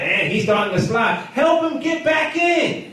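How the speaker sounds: high, urgent exhortation to the congregation; impassioned preaching. Real, not acted. Preacher urging the church to help people stay in, impassioned. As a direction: preach it high, drawing out the key phrases.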